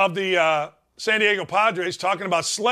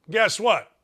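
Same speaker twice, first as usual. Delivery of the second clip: usual speaking voice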